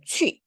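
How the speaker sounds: 취 with rounded ü, short and falling; ü vowel. Shown as a demonstration. Teacher reading a word for students, rounded falling 취. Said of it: "위 발음 해줘야 되죠".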